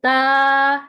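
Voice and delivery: "تا" with the tongue tip on the upper gum; letter demo. Shown as a demonstration. Teacher showing students where "تا" is made, clearly. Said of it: "زبان کی نوک جب اوپر والے دانتوں کے مسوڑوں سے".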